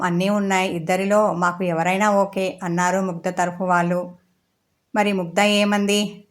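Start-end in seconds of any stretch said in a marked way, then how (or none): none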